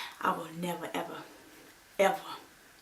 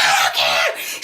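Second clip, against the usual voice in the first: drawn-out loud yell; slow, furious retold scream; enraged mom; 6 dB louder, much slower than usual. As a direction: act out a mother at peak fury. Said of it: "I've never seen my mom that angry".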